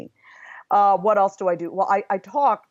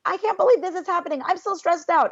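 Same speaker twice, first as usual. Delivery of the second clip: anxious inner voice, pitched much higher; panicky ego voice; 12 semitones higher than usual. Retold or imitated. Her anxious ego panicking over a crisis, high and fretful. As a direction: fret it out high and tense like a stressed ego. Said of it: "the ego voice, which is like".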